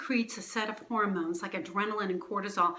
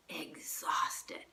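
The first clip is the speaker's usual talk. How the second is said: whispering; 6 semitones lower, 8 dB quieter than usual. Acted. Drained after adrenaline wears off.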